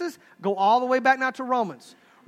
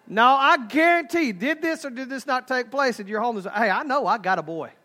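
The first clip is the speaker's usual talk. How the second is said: ordinary talk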